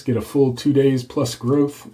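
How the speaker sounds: usual speaking voice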